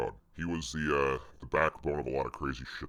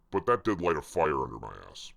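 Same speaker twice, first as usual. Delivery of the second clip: usual speaking voice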